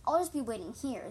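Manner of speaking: ordinary delivery, nothing marked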